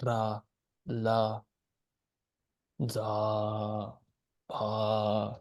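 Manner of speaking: ra, la, za, pa said level and low; flat tone. Shown as a demonstration. Teacher listing flat-tone syllables for students, level. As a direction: keep each syllable flat. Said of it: "These are more like flat".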